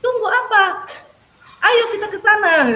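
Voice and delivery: tirukan anak berteriak, tinggi dan keras; lebih keras lagi. Retold or imitated. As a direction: mendesak dengan suara keras. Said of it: "anaknya itu lebih keras lagi". (anak mendesak guru sambil berteriak)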